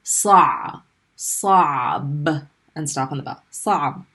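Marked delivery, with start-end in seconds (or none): 0.0-0.8 Arabic ayn in Sa; throaty ayn sound. Shown as a demonstration. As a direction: squeeze Sa from the throat. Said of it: "roll in your throat".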